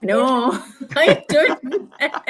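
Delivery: giggly, high-pitched and drawn-out admission; laughing denial. Real, not acted. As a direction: deny it through a laugh. Amused denial to host's question.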